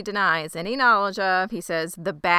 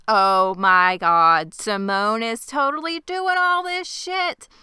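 slow, loud, rising to high pitch; exclaimed realization; 4 semitones higher, 5 dB louder, slower than usual. Retold or imitated. Viewer reacting to Simone's complaint, exclaimed realization. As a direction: stretch it out with mounting outrage. Reporting own shocked reaction to show.

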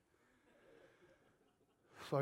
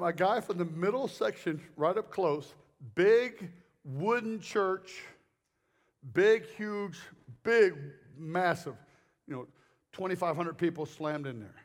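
ordinary talk

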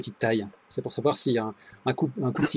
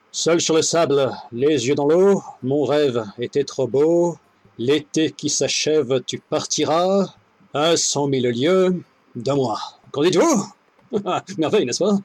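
hammy stage actor; slower than usual. Acted. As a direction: recite like a ham on stage. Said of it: "et déclame".

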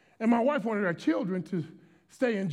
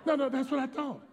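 normal talking voice

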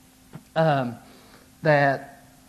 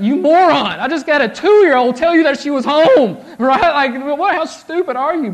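loud, high, laughing rebuke; mock scolding shout; 10 semitones higher, 12 dB louder than usual. The Holy Spirit scolding him after his son's words, loud mock shout with laughter. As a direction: bellow at yourself in jest, half laughing. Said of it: "Holy Spirit, whatever".

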